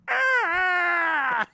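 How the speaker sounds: high loud shriek of shock; startled scream. Retold or imitated. Boy realizing it's not his mom, shrieking. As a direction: scream on seeing the stranger. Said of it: "and I go".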